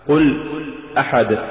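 ordinary delivery

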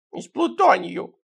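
high, slow tearful whimper; sobbing. A narrator's reading. Sidrych answers about the ring's material, acted sobbing. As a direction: whimper it through acted sobs. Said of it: "всхлипнул Сидорыч".

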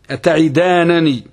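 slow "أتعدانني" ending -nanī; "أتعدانني" with a after the first nun; first nun with fatha. Shown as a demonstration. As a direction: say "أتعدانني" slowly as ata'idaananī. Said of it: "فتح النون الأولى".

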